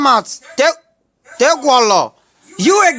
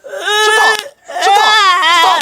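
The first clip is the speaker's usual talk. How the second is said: higher, louder comic teacher yell; mock teacher shout; 6 semitones higher, 3 dB louder, much slower than usual. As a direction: bark it like a fed-up schoolteacher. Teacher yelling at noisy pupils.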